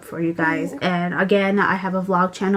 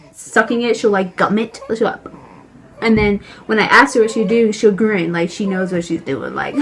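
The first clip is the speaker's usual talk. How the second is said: ordinary talk, nothing marked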